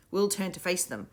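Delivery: usual speaking voice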